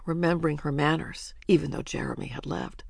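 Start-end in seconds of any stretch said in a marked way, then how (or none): none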